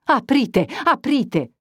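high, urgent repeated plea; insistent plea. A narrator's reading. Priest repeating his demand to the young man, urgent. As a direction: press him to open now. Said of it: "replicò il curato".

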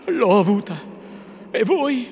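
put-on agitated young lover; urgent reunion. A narrator's reading. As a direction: answer and ask back eagerly.

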